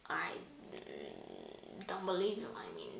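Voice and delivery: whispered disbelief at the claim; doubtful whisper. Real, not acted. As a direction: breathe it out, unconvinced.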